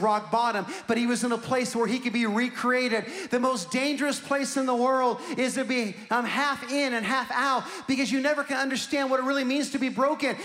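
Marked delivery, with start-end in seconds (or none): none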